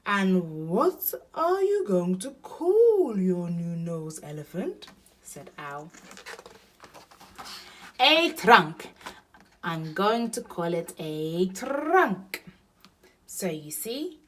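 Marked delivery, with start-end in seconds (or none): 0.0-4.9 lightly hooting owl question, loud at first; inquiring Owl. A narrator's reading. Owl asks Elephant. 8.0-12.4 loud, slow, emphatic naming; proud announcement. A narrator's reading. Elephant proudly names nose.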